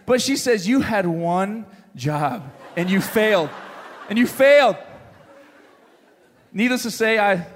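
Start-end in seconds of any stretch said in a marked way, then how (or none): none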